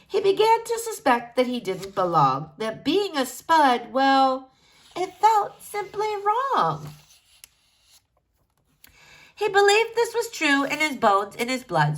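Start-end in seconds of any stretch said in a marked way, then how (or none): none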